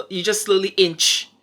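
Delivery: usual speaking voice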